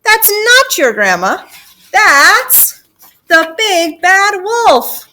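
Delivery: high, loud, drawn-out reveal; emphatic alarmed yell; shouted warning. Acted. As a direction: shout the danger, stretching the last words. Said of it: "Gertie shouted".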